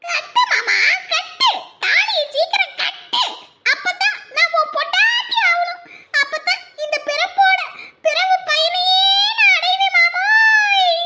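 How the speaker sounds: playful bride calling out to her groom; eager bride. Acted. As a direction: beg him to tie it, laughing and excited. Bride eagerly begs him to tie.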